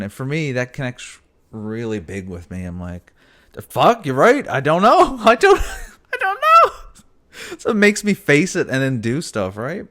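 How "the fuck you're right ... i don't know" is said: loud, high, laughing outburst; mock realisation. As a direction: burst out laughing at yourself. Imagined reaction to lyric.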